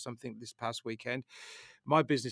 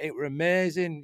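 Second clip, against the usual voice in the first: rough attempt at a Manchester accent, higher and louder; Kelly's Manchester accent; 5 semitones higher, 5 dB louder than usual. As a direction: badly imitate a friend's Manchester accent. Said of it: "I can't do her accent".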